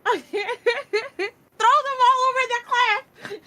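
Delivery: loud, high joking yell; mock shout. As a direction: bellow it as a joke. Joking outburst, then "just kidding.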